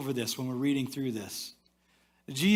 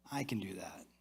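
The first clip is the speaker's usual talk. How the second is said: whispered resolve; 7 semitones lower, 12 dB quieter than usual. Retold or imitated. Quiet resolve, whispered to oneself.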